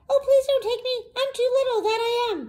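tiny, very high pleading goat; very small goat voice. A narrator's reading. Youngest goat begging the troll, very small voice. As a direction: plead in a high little squeak. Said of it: "in his very small voice".

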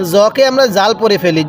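ordinary talk

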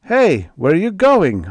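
high, loud call after them; shouting. A narrator's reading. Harry shouting after them on the beach, loud. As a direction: yell across the sand. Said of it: "I shouted".

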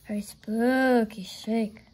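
slow, eerie drawn-out line; spooky storytelling. A narrator's reading. Narrator repeats the scary line for listeners, slow spooky voice. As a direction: linger on "spooky" for suspense.

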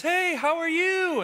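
high, bright, eager greeting; a churchgoer greets someone, high and super excited. Retold or imitated. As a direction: greet them like you're thrilled. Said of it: "And they're super excited".

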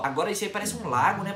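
ordinary talk

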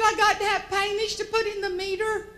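very high, urgent country explanation; imitating a frantic South Georgia farmer; panicked farmer. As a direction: blurt it out like a flustered good old boy. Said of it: "was in a little bit of a panic".

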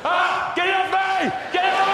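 high, loud panicked yelling; panicked shout. Acted. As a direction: scream to get it off. Hawk on him, panicking.

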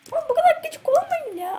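very high squeaky plea. Real, not acted. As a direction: squeak it out, exasperated.

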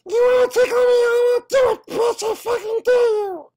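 very high, loud squeaky puppet voice; bad Elmo voice. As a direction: shriek it like a crazed puppet. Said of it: "one bad voice impression".

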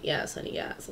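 breathy whispered "yes, honey"; sassy whisper. Pretend. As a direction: whisper it like a private hype-up.

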